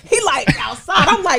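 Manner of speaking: loud, high, laughing disbelief; can't believe it, laughing loud; laughing shout. Real, not acted. Disbelief at son outside daycare.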